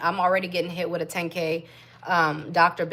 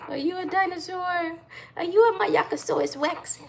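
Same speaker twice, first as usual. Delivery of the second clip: much higher, playful questioning coo; 10 semitones higher than usual. Real, not acted. Mom playing with her baby.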